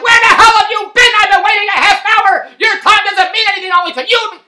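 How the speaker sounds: very high, very loud mock rant; mock furious yell. Imagined wife yelling at late friends, loud mock fury. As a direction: blast them as if they're half an hour late. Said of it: "She didn't say any of that stuff".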